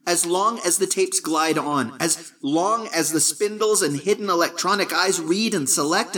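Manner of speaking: put-on young voice over a phone, matter-of-fact; young Barton. A narrator's reading.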